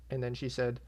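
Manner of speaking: normal talking voice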